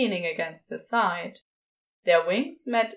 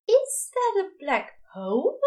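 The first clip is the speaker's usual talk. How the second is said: gasping; 10 semitones higher than usual. A narrator's reading. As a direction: gasp it out in wonder. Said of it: "Aziraphale gasps".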